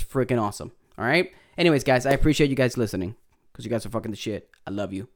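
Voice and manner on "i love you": usual speaking voice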